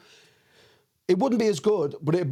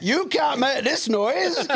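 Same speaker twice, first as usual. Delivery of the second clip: mock locals; 10 semitones higher, slower than usual. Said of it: "they were like".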